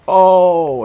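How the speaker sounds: loud high groan of despair; loud frustrated oh; anguished cry. Real, not acted. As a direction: howl at the near miss. Near miss on goal.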